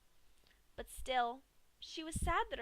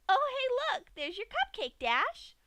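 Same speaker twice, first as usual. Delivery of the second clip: much higher, louder discovery; excited Pinky; 9 dB louder than usual. A narrator's reading. Pinky shows Dash her cupcake, thrilled. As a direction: point it out gleefully.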